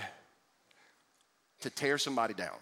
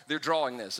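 ordinary delivery

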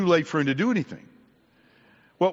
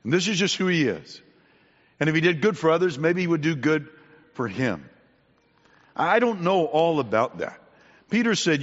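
ordinary talk, nothing marked

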